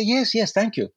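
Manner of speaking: can't believe the luck, welcome them gladly; thrilled yes. Retold or imitated. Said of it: "I was so excited".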